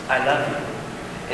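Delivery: slow, whispered, tentative confession; hushed questioning I love you. Retold or imitated. A man at the altar confessing love, slow and whispered. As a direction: breathe it softly as if asking. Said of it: "you basically phrase it as a question".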